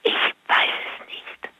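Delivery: whispering with a lost voice; strained whisper, no voice left; voiceless whisper. Real, not acted. Caller whispering to the radio host because her voice is gone.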